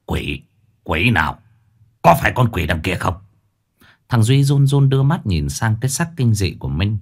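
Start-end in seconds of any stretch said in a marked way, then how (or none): 0.1-3.1 growling through teeth. Acted. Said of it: "đoạn gằn giọng".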